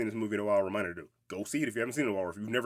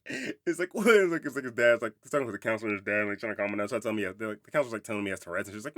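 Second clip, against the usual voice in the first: ordinary delivery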